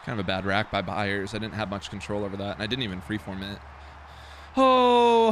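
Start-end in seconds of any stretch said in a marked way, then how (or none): 4.6-5.3 long, high, loud "oh"; loud groan. Real, not acted. Gamer wanting a touchdown for Olave, loud drawn-out groan. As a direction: draw out a loud cry of frustration. Groaning over missed play.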